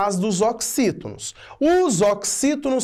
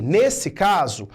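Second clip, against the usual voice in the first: ordinary talk, nothing marked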